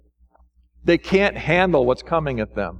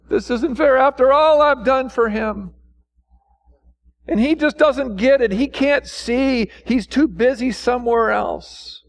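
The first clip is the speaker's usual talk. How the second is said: higher-pitched mock grievance; mock whining; 7 semitones higher than usual. Preacher voicing people who feel God overlooks them, mock whining. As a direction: imitate someone grumbling that they deserve better. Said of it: "See, they're whining".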